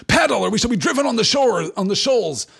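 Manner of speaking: loud, high urgent shout. A narrator's reading. Boromir shouting at the rowers in alarm. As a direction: yell the command across the boats. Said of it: "shouted Boromir".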